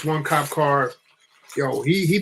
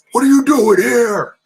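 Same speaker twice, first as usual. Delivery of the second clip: high, loud demanding question; yelling cop; 9 semitones higher, 6 dB louder than usual. Retold or imitated. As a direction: imitate a cop yelling at kids. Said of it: "He's yelling, like, screaming".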